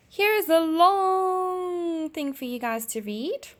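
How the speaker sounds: high, slow playful announcement. Pretend. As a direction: tease them about the long read. Teacher playfully announces long text.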